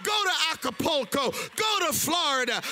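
usual speaking voice

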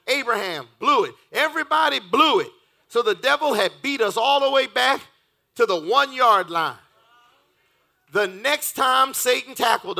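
loud, rising sermon climax; preaching at full force. Acted. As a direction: whoop the story like a play-by-play.

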